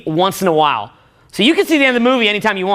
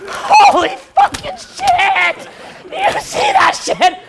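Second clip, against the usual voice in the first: much higher, loud, slow, stunned; hyped shout; 4 dB louder, much slower than usual. Retold or imitated. Fan telling friends about the movie, shouting with excitement. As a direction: yell it like you just saw the best movie ever. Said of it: "You go to your friends".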